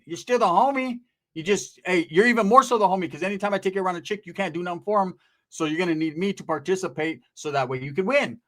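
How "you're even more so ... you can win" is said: usual speaking voice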